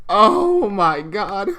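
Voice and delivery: slow, drawn-out oh my God; stunned. Real, not acted. Reacting to the tags, slow stunned disbelief. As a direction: stretch it out in disbelief.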